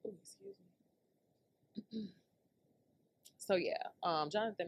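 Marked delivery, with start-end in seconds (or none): 0.1-2.1 hushed whispered excuse. Real, not acted. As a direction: whisper a quick apology. Excusing herself quietly.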